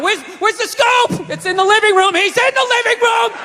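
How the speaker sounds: high-pitched mock panic, building; hammed-up frantic scramble, voice climbing high; panicked yelling. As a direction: yell frantically across the house. Acts out panicked scramble from attacker.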